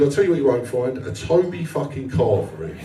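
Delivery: usual speaking voice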